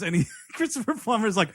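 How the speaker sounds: ordinary talk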